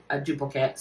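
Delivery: normal talking voice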